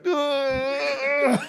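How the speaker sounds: high cry of laughter; laughing shriek. Retold or imitated. Trying to have class, high laughing shriek. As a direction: crack up again, helplessly. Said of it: "I would go back like".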